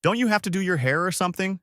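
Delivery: high, quick mocking question; sarcastic. A narrator's reading. Goku needling Bulma as she catches up, sarcastic. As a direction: tease with a sarcastic lilt up high. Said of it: "Goku asks sarcastically".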